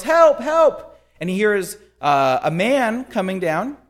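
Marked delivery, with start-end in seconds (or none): 0.0-1.0 loud, very high cries for help; acted yell. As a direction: yell for rescue at the top of the pitch. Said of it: "He yells".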